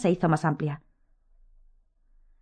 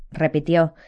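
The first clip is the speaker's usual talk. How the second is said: ordinary delivery, nothing marked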